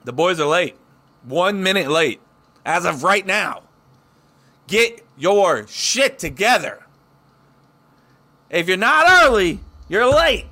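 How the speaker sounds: loud, slow fake-angry rant; mock scolding shout. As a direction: bark it like an angry boss. Scolding the late co-host.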